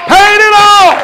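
high, loud preacher's cry; impassioned shout. Real, not acted. Preacher proclaiming the price was paid, full-force shout. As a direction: belt it out to the congregation.

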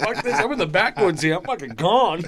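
high, loud panicked rush. Retold or imitated. Recalling his own panic in the backwoods, high and loud. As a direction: blurt it out in a scared panic. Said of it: "I'm like".

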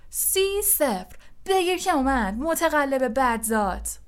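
high, stretched-out score call, then a taunt; gloating shout. A narrator's reading. Lee taunts the cheat after a goal, gloating shout. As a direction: crow the score high and drawn out. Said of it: "جردن فریاد زد".

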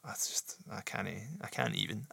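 hushed, worn-out murmur; exasperated whisper. Real, not acted. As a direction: fade out, too fed up to go on.

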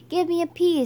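high, loud cheerleader call; yelled cheer. A narrator's reading. Skunk leads the Pluto cheer at recess, storybook yell. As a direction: call out the letter to a crowd. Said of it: "Skunk yelled".

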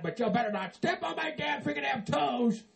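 ordinary talk

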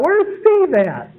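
high, slow call across the site; a lightly voiced worker calling around a barn site. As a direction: call out for someone who has wandered off.

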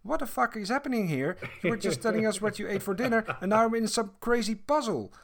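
high, fast, baffled outburst; a confused brother breaking the fourth wall in disbelief; exasperated brother. Acted. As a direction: brother C protests the sudden puzzle, high and fast. And Brother C says.

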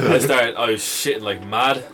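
usual speaking voice

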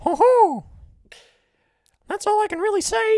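squeaky falsetto cartoon mouse; very high, giggly cartoon voice; Mickey Mouse. Shown as a demonstration. Host trying a requested impression, high Mickey Mouse voice. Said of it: "Mickey Mouse".

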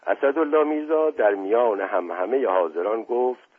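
usual speaking voice

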